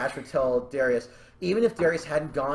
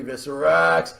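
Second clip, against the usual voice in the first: loud acted call; 5 semitones lower, 4 dB louder, slower than usual. Speaker pitching how a character says a name, loud call.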